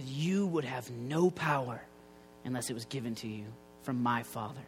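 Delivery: calm Jesus. Retold or imitated. Jesus answers Pilate calmly.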